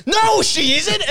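high yelled contradiction; mock shouted denial. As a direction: yell it back in a hammed-up quarrel. Acted shouted contradiction in quarrel.

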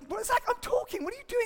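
very high-pitched, put-on protest; mock-flustered high voice; flustered dad. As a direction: squeak it out as an interrupted, indignant dad. Dad interrupted by shouting son, acted.

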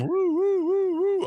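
very high-pitched string of teasing woos; playful high woo. Pretend. Host reacting to red light district remark, playful high wooing.

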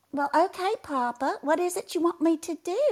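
put-on high, cute little Southern girl; small high girlish Southern voice; Tilly. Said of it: "this cute little Southern girl".

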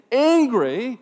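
hammed-up irritation; angry outburst. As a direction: punch it out, mock-furious. Acting out his anger in traffic.

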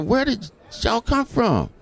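high, slow amazed question. Retold or imitated. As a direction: ask it high and slow in wonder. Amazed at the crowd.

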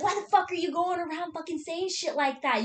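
mildly heated mock reprimand; retold scolding. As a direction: imitate someone giving an annoyed dressing-down. Retells him scolding her aside.

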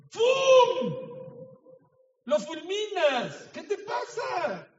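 sudden high angry shout at a spouse; furious outburst. Acted. Husband shouting at his wife in anger. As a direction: blow up at her, loud and high.

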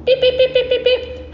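high, even dialing beeps; imitated phone beeps. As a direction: mimic phone keys in a high pitch.

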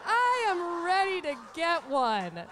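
ordinary talk

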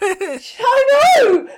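high, loud burst of agreement; exasperated shout. Real, not acted. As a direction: cry out in real exasperation. Exasperated agreement about the companion.

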